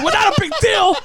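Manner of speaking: very high-pitched mock disbelief; retold squawk of disbelief, pitch shooting up; incredulous retort. Patient throwing clinic girl's words back, high incredulous retort.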